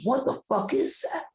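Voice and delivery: high startled question. Retold or imitated. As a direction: ask it high, spooked by what you see. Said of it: "My buddy goes".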